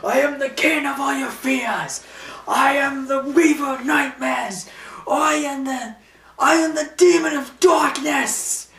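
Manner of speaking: high, loud, grandiose villain shouting; over-the-top villain boasting; Scarecrow rant. Retold or imitated. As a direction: declaim each title like a hammy supervillain. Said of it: "Scarecrow's like this".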